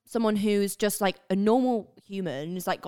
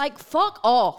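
loud, slow, higher-pitched swearing; mock outrage; 7 semitones higher, 8 dB louder, much slower than usual. Creator rejects American accent as normal, mock outrage. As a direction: dismiss it with drawn-out playful indignation.

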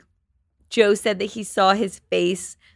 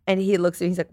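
usual speaking voice

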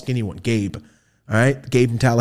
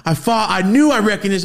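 excited clerk voice; 9 semitones higher, 5 dB louder than usual. Retold or imitated. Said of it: "He goes".

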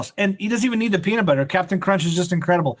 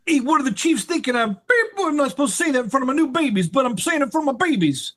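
high, hammed-up New York accent; 8 semitones higher than usual. Co-host mimics Matt ranting at the Chiefs, New York accent. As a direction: play an exasperated New Yorker, pitched way up. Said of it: "it's all gonna be in a New York accent".

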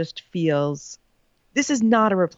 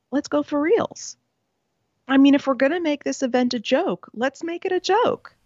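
exasperated sarcasm; 6 semitones higher than usual. Real, not acted. Sarcastic push, pitch way up.

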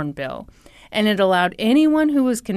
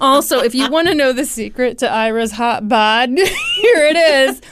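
laughing; 4 semitones higher, 5 dB louder than usual. Real, not acted. Laughing reveal.

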